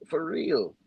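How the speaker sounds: ordinary talk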